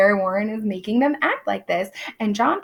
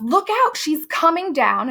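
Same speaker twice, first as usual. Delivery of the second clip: high, rising mock yell of warning; Abigail yells; 7 semitones higher than usual. Abigail warning the girls, high mock yell. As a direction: call out the warning in a thin high voice. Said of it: "Abigail yells".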